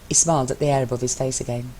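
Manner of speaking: ordinary delivery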